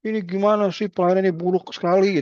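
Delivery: high, indignant mock complaint; a visitor bursting out of the ward, fed up; complaining patient. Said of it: "marah-marah".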